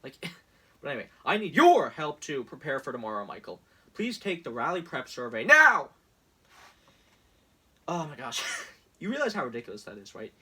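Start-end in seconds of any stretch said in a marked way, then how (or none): 1.2-5.9 loud, pushy sales-pitch voice; mock campaign ad announcer; hammed-up ad read. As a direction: sell it like a cheesy infomercial. Reading Trump campaign text aloud.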